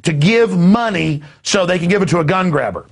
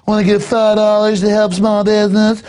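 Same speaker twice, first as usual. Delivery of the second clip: high, louder put-on barista voice; mock trendy barista; 7 semitones higher, 4 dB louder than usual. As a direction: pester the customer, pitched up. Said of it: "have the trendy behind the counter go".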